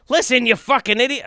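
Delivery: high, irate put-on rant voice; abandoned attempt at an angry comedian's voice; Bill Burr. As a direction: scold them in a high-pitched comic impression. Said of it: "Bill Burr".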